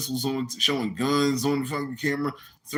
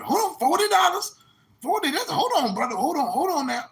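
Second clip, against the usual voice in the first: mocking club owner; 14 semitones higher than usual. Club owner fussing at a guest over a pile of change. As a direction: squawk it like a fed-up club owner.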